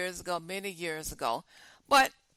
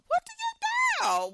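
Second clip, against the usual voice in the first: much higher, slow, drawn-out despairing question; exasperated plea; 5 dB louder, slower than usual. Real, not acted. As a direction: throw it up to the heavens, at a loss.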